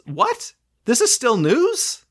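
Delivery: high-pitched, drawn-out disbelief; incredulous. Acted. Host reacting to still waiting for trams, incredulous. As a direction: question it in high, slow astonishment. Mock disbelief at tram news.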